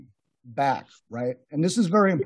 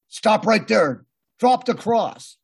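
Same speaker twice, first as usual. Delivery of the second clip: commanding shout, higher and louder; mock police order; 6 semitones higher, 4 dB louder, slower than usual. Undercover cops ordering him to drop the cross, shouted. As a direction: bark it like a cop stopping a suspect.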